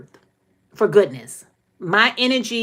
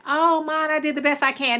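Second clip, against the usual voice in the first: put-on higher, pleading kid voice; mock-pitiful higher whine; whining child; 7 semitones higher than usual. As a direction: imitate a kid whining an excuse to mom. Child's whiny excuse to mother.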